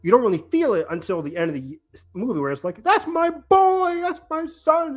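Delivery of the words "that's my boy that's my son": high, slow, wailing imitation of a father; imitating grieving dad. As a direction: ham up a heartbroken father's cry, pitched high and drawn out. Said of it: "Is that one of the all-time great, uh, line readings?".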